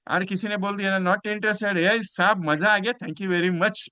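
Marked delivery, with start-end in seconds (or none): 1.1-3.9 higher, upbeat mock brush-off; acted breezy thanks; cheerful refusal. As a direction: turn them down merrily, without real laughter. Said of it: "मस्त हंसते हंसते".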